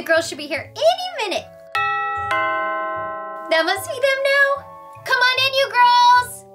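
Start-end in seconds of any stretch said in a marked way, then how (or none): 5.0-6.4 high, singsong call to the door. Acted. Elsa to friends at the door, calling out. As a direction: call out to guests in another room.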